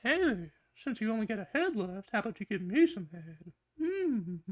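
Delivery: high, soft creepy voice; quiet, high-pitched creep; Herbert. A narrator's reading. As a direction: leer in a high, hushed voice, then hum. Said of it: "and Herbert says".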